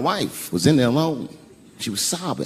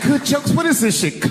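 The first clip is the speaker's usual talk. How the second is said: very high, indignant outburst; high-pitched scornful question; incredulous wife. Retold or imitated. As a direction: throw it back in mock disbelief. Wife's incredulous angry retort.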